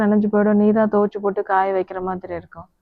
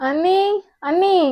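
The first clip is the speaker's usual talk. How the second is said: high rising call after someone leaving; 9 semitones higher, slower than usual. Acted. Calling after the sister-in-law walking out, a high rising call. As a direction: call her back before she goes.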